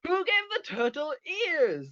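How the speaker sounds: high mock outcry at normal volume; high, hammed-up question; high-pitched clip quote. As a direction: re-enact the line way up in pitch. Re-enacting a favourite clip.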